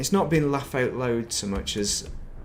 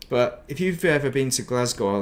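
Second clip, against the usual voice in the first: usual speaking voice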